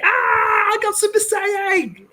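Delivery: very high, slow, shouted power-up. Retold or imitated. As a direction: yell it like transforming Super Saiyan. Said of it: "you're gonna say".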